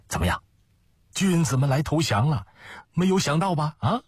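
high-pitched, crowing gloat; triumphant. Acted. Schemer boasts to Ma that the gentlemen surrendered, triumphant. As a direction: crow over the surprise victory. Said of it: "得意的响亮的笑声".

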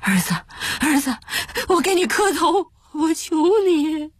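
strained, coaxing plea rising to a wail; desperate begging. Acted. Father begging his eldest son to release him, desperate. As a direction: implore him tearfully, pitch climbing at the end. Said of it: "只好再去求大儿子".

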